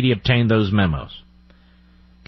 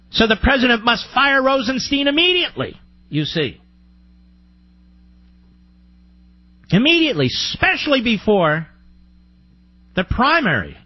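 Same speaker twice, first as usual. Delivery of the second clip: mocking voice; 9 semitones higher than usual. Mocking opponents' reasoning.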